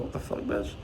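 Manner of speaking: under-breath whispered curse; whispered shock. Retold or imitated. Muttering to himself about waiting manager, whispered. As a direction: hiss it to yourself in disbelief.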